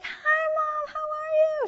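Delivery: put-on very high, loud squeal of a child greeting Mom; sky-high, drawn-out kiddie greeting; excited little girl. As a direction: squeal it out, imitating a thrilled small girl. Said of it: "She's all excited".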